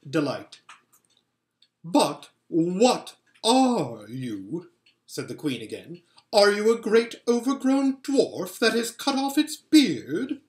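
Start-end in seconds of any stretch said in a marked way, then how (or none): 1.8-4.7 slow, haughty questioning queen; imperious Queen. A narrator's reading. 6.3-10.4 higher, louder mocking queen; cold queen scoffing at the boy; scornful Queen. A narrator's reading. Queen voice held.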